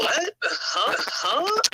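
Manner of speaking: as if you can't believe your ears; stunned disbelief. Retold or imitated. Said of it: "I was honestly in shock".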